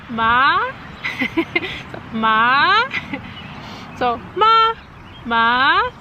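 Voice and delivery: ma rising like a question; ma sliding upward, as if asking; second tone ma. Shown as a demonstration. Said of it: "it's almost like a question".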